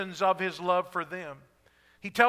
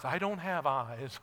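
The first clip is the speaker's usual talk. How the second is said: dry reply; 8 semitones lower, 7 dB quieter, slower than usual. Retold or imitated. Blind man answers.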